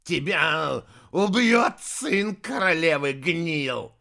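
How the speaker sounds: loud, slow, high threat; vicious barking. A narrator's reading. Heir throttling Anfisa, barking viciously. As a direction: spell out the death threat savagely. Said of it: "злобно рявкнул наследник".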